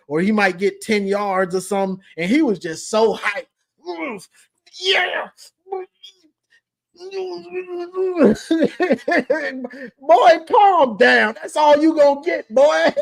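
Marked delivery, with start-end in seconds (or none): 4.8-5.3 hyped yeah. Retold or imitated. Imitating hyped Quinshawn. 10.0-12.9 mock scolding. Scolding hyped runner.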